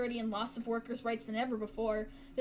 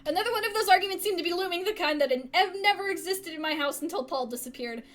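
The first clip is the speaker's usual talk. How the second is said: fast, louder, higher rushed reading; hurried; 5 semitones higher, 5 dB louder than usual. Real, not acted. Player rereading the line after the knife shock, rushed. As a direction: race through it, still rattled. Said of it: "I could see the knife".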